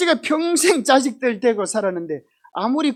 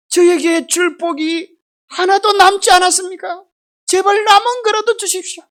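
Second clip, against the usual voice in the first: desperate pleading; 6 semitones higher, 4 dB louder than usual. Retold or imitated. Esau to his father, desperately begging. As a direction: beg for any blessing left.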